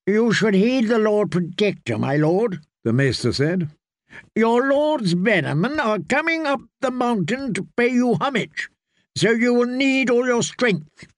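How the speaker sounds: high, lightly voiced old maester; a maester coaxing his young lord. A narrator's reading. Maester speaks.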